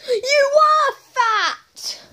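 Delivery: high, drawn-out exclamation; exaggerated high-pitched retort; emphatic taunt. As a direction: rub it in, high and slow. Acted blunt retort to moaning Sonic.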